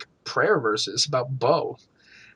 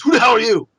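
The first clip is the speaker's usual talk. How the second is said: higher, much louder hostile yell; retold angry shout; 7 semitones higher, 8 dB louder, faster than usual. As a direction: bark it down from the window. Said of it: "he goes".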